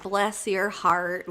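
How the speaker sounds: slow Southern bless your heart; Southern accent. Retold or imitated. Retelling what her husband said in a slow Southern accent. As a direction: drag it out in a Southern twang. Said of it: "like, so country".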